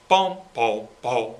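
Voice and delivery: each bom leaned on, with space; accented but not short. Shown as a demonstration. Director showing accents are not played short, emphasized bums. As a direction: sing with emphasis, not clipped. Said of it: "a little bit of emphasis".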